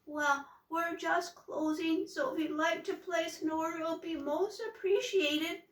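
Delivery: high, wobbly, nervous voice; trembly voice. A narrator's reading. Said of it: "In a trembly, wobbly voice".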